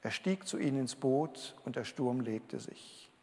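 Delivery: hushed echo of the verse; quiet repeat. Shown as a demonstration. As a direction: let it sink in, softly. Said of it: "mit dem schönen Vers".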